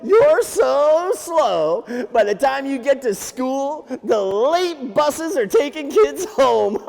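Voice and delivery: high, loud, drawn-out teasing; mock a slowpoke, stretching the words high; taunting rabbit. Rabbit taunts the slow turtle.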